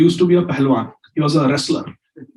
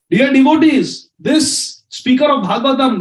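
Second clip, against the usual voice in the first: raised, higher, slow declaring voice; public accusation; 8 semitones higher than usual. Retold or imitated. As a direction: call out to the whole hall as the wronged maid. Maid publicly accuses the speaker.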